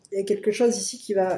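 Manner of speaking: ordinary delivery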